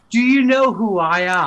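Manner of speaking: high, loud put-on indignation; mock self-important outrage. Mimics friend's self-important protest.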